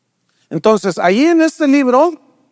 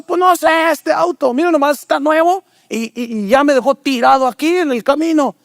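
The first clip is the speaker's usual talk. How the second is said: put-on higher, complaining young driver; frustrated driver; 5 semitones higher than usual. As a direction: whine about the new car that broke down. Driver complains to old man. (conductor quejándose al anciano, voz más aguda)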